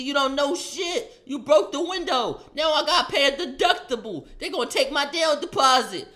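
high, loud mock mom scolding; mom yelling. Mom yelling at son over the broken window. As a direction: shriek it like an angry mom.

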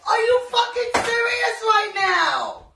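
loud, high, slow furious yell; mock outrage. Girlfriend yelling at boyfriend over the prank call, mock fury. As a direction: blow up at him like a jealous girlfriend. Caught partner on phone prank.

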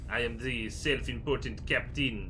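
self-important captain in a bad French accent; failed French accent. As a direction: attempt a French accent that drifts into its own thing. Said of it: "why the captain has this accent".

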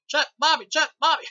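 high, loud calling out; mock yell. Ernest calling for Chuck and Bobby while beaten up. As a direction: yell the names for help. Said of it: "Jim Varney keeps going".

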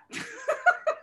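2 a sudden high cackling laugh; 3 break into a loud, high laugh; 4 laughing hard at the punchline; 1 high burst of laughter. Real, not acted. Host laughing at own story.

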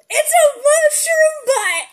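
high, loud, slow shriek of joy; delighted shout. Real, not acted. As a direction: shriek it out, thrilled.

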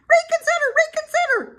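very high, loud goose cry. A narrator's reading. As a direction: squawk it out as a goose, far up in pitch. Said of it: "cried the goose".

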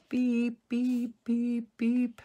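high, loud, evenly spaced beeps; imitated backup beeper. Vlogger mimicking equipment backing up late at night, imitated backup beeper. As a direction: mimic a vehicle's reversing alarm. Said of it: "all of a sudden I hear this".

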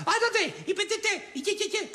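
squeaky high mock panic, stammering nonsense; mocking panicky babble. Nico mocks her useless panic in a squeaky falsetto. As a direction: imitate her flapping about uselessly, way up high.

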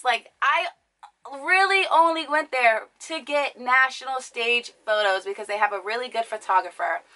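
ordinary delivery

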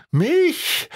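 high, sharply rising question; außer sich. A narrator's reading. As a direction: exclaim it in stunned disbelief, pitch leaping up. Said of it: "rief er außer sich". (außer sich, fassungslos)

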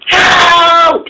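high, held distress cry; cry for help. A narrator's reading. Runaway engine calls to anyone ahead, crying out. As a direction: call out for help, high and drawn out. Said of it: "cried the voice".

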